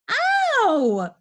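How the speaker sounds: loud, high, drawn-out ow; pained shriek. A narrator's reading. Peter shrieking as his brother attacks him, loud pained wail. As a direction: yelp out long in pain. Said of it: "shrieked Peter".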